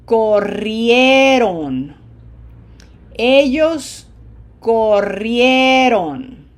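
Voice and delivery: Spanish teacher modeling stressed endings; stressed syllables drill. Shown as a demonstration. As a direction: punch the highlighted syllable of every form, slowly. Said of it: "Don't let me forget the highlighted syllables".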